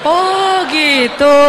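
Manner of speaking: seruan "oh" panjang, tinggi dan keras; baru paham. Retold or imitated. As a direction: menirukan orang yang baru mengerti, diulur lambat. Companions finally get the joke. (seruan paham yang dilebih-lebihkan)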